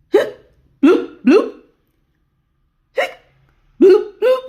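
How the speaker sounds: high hic, then loud popping bloops; bouncy cartoon bloop noises; playful bubbly hiccup sound; bubble hiccup.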